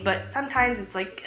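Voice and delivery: ordinary delivery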